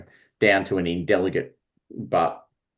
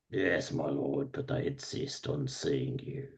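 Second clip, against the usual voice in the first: subdued servant; 6 semitones lower, 13 dB quieter than usual. Acted. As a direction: answer the shouting master quietly and deferentially. Servant answers his shouting master.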